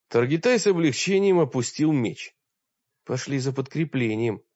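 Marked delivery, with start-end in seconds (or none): none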